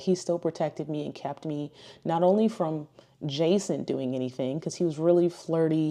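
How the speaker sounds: ordinary talk, nothing marked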